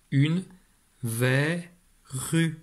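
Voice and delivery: verrue stretched, open è before the double R; teacher decoding a word; careful decoding. Shown as a demonstration. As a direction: sound out une verrue slowly for a child. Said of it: "Ça donne donc".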